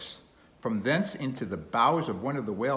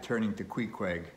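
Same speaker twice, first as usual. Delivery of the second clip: ordinary delivery, nothing marked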